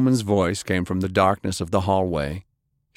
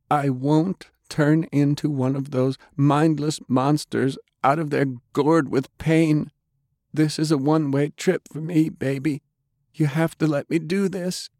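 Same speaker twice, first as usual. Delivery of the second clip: high-pitched woman's voice, sorrowful; dying woman, pleading; 7 semitones higher than usual. A narrator's reading. Undead Delilah asking Jake to let go, pleading. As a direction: plead gently in a high female register.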